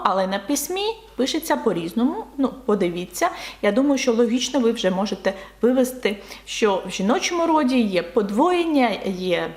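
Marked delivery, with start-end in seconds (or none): none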